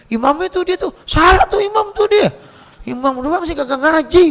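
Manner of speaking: hammed-up gossip, pitch climbing; gossiping complaint. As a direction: badmouth the imam like a coffee-stall gossip. Gossiper badmouths the imam.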